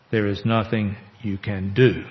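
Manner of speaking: "do" given the final weight and falling; stress on do. Shown as a demonstration. Preacher reading James Sanders aloud, stress on "do". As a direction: land hard on "do" in "There is nothing you can do". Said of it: "he italicizes the words I'm going to emphasize".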